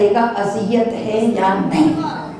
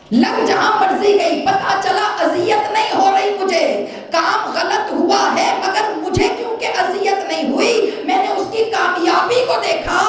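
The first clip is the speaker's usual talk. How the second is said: much higher, shouting sermon cadence; full-force preaching; 8 semitones higher than usual. Real, not acted. Preacher explaining the cricket ball lesson, impassioned.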